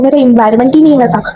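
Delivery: usual speaking voice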